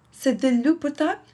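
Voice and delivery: fast, bright read of the phrase; quick practice take; quick repeat. Shown as a demonstration. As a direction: rattle off C'est de l'eau potable quickly. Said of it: "We'll repeat".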